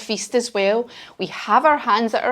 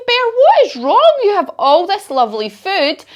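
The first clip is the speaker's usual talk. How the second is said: concerned friends; 9 semitones higher than usual. Acted. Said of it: "And we say".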